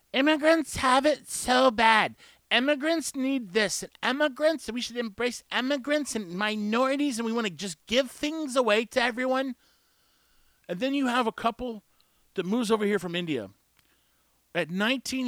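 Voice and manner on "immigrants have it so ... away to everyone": high, exaggerated voice of complaint; mock pleading advocate. Imitating people who speak up for immigrants, high mocking voice. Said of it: "you hear people saying".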